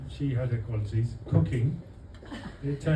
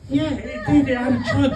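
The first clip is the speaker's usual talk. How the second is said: high, loud, laughing eagerness; husband proudly insisting his orchid had a trunk, high and eager; 14 semitones higher, 6 dB louder than usual. Retold or imitated. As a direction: insist proudly, much higher.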